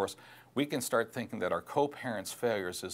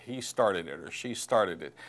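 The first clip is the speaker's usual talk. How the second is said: a hint of a whiny child, slower; schoolyard kid; 3 semitones lower than usual. Parent sounding like a bickering schoolyard child, lightly voiced. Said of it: "sounds a little bit like the schoolyard".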